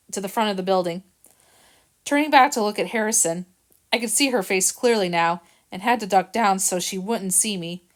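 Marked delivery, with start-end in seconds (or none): none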